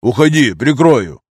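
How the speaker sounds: loud, urgent call; shouted order. A narrator's reading. Fighter sending comrade away under fire, urgent shout. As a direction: shout it over gunfire.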